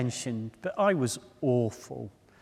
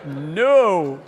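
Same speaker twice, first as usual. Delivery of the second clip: loud, rising chain of no's; your gut shouting no with every try; hammed-up loud protest from within; insides refusing; 4 semitones higher, 9 dB louder, much slower than usual. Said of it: "everything inside you is going".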